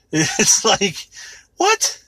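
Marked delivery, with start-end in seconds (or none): 1.6-2.0 incredulous what. Real, not acted. Disbelief at the lyric.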